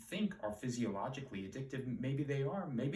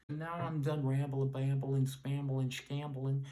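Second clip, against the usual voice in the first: playful rhyming; 7 dB louder, slower than usual. Real, not acted. Playful nonsense rhyming sign-off.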